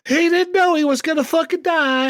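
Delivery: excited mock quote, slipping into Trip's accent. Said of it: "[name] is now speaking in Trip's accent".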